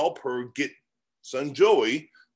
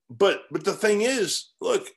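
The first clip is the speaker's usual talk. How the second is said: normal talking voice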